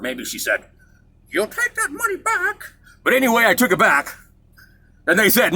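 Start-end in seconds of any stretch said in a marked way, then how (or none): 1.3-2.7 much higher imitation of a shorter mom; a lighter retake of mom's order; mom, second try. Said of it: "She's a little bit shorter".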